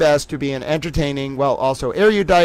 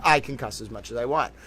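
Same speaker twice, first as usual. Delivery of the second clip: much softer, mumbled to herself; quiet aside; 4 semitones lower, 9 dB quieter than usual. Real, not acted. Streamer repeating the permission to herself, quietly. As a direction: say it under your breath, pleased.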